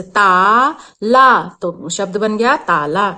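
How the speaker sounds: ता and ला said slowly with the mouth wide open; open-mouth aa demo. Shown as a demonstration. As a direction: show how ताला opens the mouth. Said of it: "हमारा mouth open होता है". (ताला को मुँह खोलकर, धीरे बोलें)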